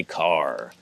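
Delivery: "car" ending in a clear American R; rhotic American. Shown as a demonstration. Showing how Americans say it, rhotic "car". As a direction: pronounce the R at the end of "car". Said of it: "Americans say".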